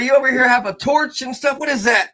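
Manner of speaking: high mock-scandalized parent voice; hammed-up parental disbelief; shocked parents. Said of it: "They're like".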